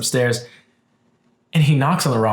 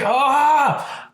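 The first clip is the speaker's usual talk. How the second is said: higher mock exasperated ah; frustrated yell; 13 semitones higher than usual. Fed up with the repairman, a higher frustrated ah. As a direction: let out a frustrated ah.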